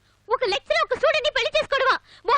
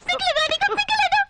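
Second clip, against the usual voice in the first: furious shout; 8 semitones higher than usual. Acted. Lecturer yelling at the student who deceived her, furious shout. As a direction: blast him with shame and fury.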